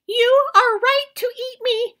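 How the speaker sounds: high, loud squirrel voice; high loud squirrel plea; pleading squirrel. Acted. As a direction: plead quickly to the bear.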